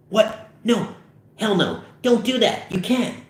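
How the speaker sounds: high-pitched panicked objection; alarmed protest. Acted. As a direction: refuse in rising alarm. Objects to plan to steal booze.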